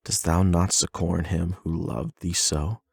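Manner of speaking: ordinary delivery, nothing marked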